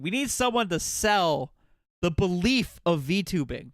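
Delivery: normal talking voice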